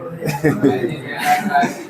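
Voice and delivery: a quiet amused laugh to himself; short real chuckle after teasing about the madam; soft chuckle. Real, not acted. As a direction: laugh softly under the breath. Teacher laughing at own joke.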